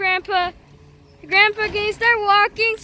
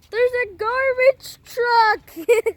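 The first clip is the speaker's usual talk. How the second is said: slow, drawn-out reveal; slow dramatic announcement; 2 semitones higher, much slower than usual. Pretend. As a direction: stretch it out like big news.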